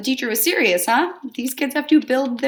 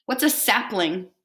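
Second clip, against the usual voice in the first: ordinary delivery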